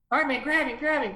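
high, eager grab him grab him; urgent coaching shout; excited urging. Retold or imitated. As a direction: egg him on to grab the bird. Urging brother to grab bird.

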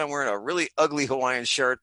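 usual speaking voice

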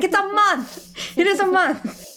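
high, slow, dragged-out whine on each word; high slow mock whine. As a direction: stretch it out like a comic complaint. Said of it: "And I'm like".

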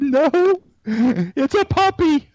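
put-on very high, slow kid's voice breaking into laughter; excited little boy. Host delivering the joke's punchline as an excited boy, laughing. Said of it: "the little boy's getting all excited".

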